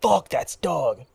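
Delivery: slow drawn-out Fuck that's Doug; mock drawled catchphrase; friend's catchphrase. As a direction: drag it out like the buddy. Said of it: "it's gonna be like".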